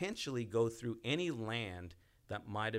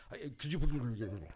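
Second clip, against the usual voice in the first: halting mock plea, stumbling over "please"; stammering, hard-won apology; struggling to say it; 3 semitones higher, faster than usual. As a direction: act someone who can barely get the words out. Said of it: "They can't get the words out".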